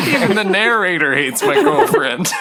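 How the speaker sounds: high, laughing through words; giggling while asking. Real, not acted. Player laughing at GM.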